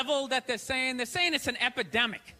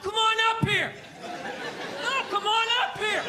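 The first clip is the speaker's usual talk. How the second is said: mock taunting shout; 9 semitones higher than usual. Drunk fans taunting the player into the stands, mock shouting. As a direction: dare the player to climb up. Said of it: "they're always calling him up".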